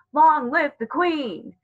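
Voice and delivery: high, slow ringing cry; slow, high, loud proclamation. A narrator's reading. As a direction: call it out like a loyal herald. Proclamation cry at notice's end.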